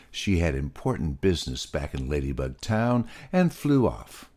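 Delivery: usual speaking voice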